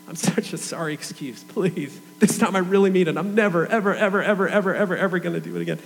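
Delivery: hammed-up frantic promise, repeated words tumbling out; mock desperate pleading. Teenager promising God he will never sin again, mock desperate.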